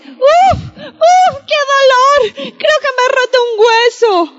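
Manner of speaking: high, whimpering cries of pain. Acted. Fallen olive complaining to her friend, high hammed-up pain cries. Olive falls while climbing.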